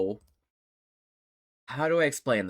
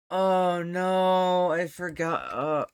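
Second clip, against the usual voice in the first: drawn-out, higher groaned words; loud dismay; 7 semitones higher, 5 dB louder, much slower than usual. Real, not acted. Player remembering how he talks, wailing in dismay. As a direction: wail it slowly after a laughing fit. Recovering from laughing fit, dismayed.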